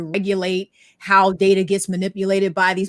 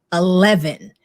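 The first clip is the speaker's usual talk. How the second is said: usual speaking voice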